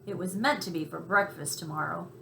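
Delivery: ordinary delivery, nothing marked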